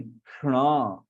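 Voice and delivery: क्षणा drawn out slowly, tongue curled to the palate; slow retroflex ṣṇa. Shown as a demonstration. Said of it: "श और अण ऐसा होता है".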